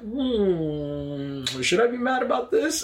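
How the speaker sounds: rises high on the question; mock pondering. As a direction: wonder aloud if it's worth being mad. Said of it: "I was like".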